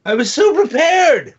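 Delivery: high, loud, slow hammed-up lament; mock despondent wail. Host mourning his lost bottle opener, hammed-up wail. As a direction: wail it like a ruined man, half joking. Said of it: "You ever seen a man so despondent?".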